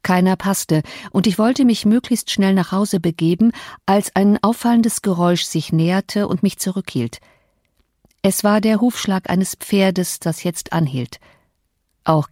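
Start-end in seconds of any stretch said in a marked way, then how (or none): none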